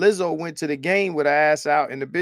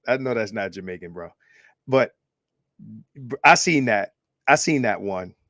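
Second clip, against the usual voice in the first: ordinary talk, nothing marked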